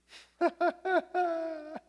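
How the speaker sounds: high, loud put-on laugh; imitated jeering laughter; mocking laugh. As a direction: laugh in ridicule, high and staccato. Acting how people mocked Cubs fans.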